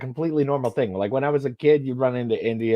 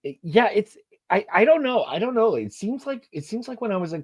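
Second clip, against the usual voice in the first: pitched way up, exasperated; exasperated high voice; 11 semitones higher than usual. Real, not acted. Venting to co-host about accents, exasperated high voice. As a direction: voice jumps up in disbelief.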